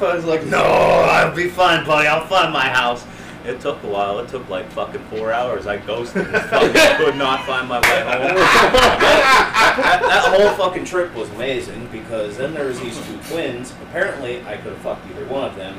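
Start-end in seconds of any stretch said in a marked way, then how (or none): 0.5-3.0 put-on slow, loud drunken answer; slow drunk reply. 8.3-11.9 high, loud laughing; laughing exclamation. Real, not acted.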